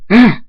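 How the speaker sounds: short, high, loud scream; startled scream. A narrator's reading. Friends scream as Zara teleports in, startled. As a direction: shriek in sudden fright. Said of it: "and Jaquan scream".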